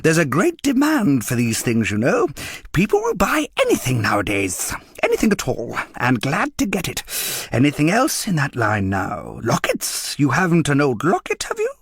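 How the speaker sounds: high, wheedling junk dealer voice; coaxing dealer. A narrator's reading. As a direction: sell it like a smooth street trader. Dealer pushing sale.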